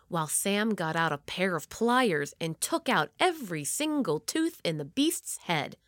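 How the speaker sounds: usual speaking voice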